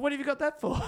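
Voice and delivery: mock-baffled dad asking about the safety pin; square dad. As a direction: play the baffled square father. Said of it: "He said".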